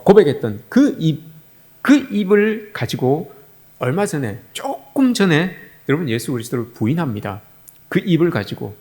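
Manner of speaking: ordinary talk, nothing marked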